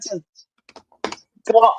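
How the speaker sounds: normal talking voice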